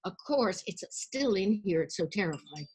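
normal talking voice

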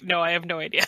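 low, soft mock reply; understated clueless impersonation; imitated cluelessness. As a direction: drop it low and quiet, imitating someone with no clue. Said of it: "And you're like".

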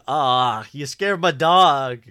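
high, loud, slow whine; imitating Jeffrey. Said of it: "His delivery on".

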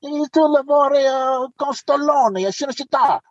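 imitated Italian voice; Italian police captain. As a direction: draw it out high, as an impressed Italian. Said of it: "he says".

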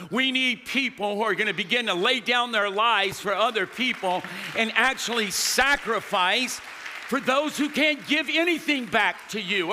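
normal talking voice

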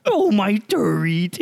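high, slow, goofy clown voice; dim-witted Pennywise. Pretend. Gamer clowning around on Overwatch, high slow Pennywise voice. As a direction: draw it out like a spooky simpleton. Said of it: "mentally deficient Pennywise".

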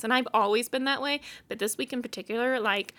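ordinary delivery

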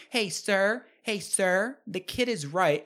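lifted voice, imitating a concerned woman; intervening woman. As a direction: call out like a bystander stepping in.

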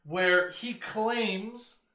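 ordinary delivery

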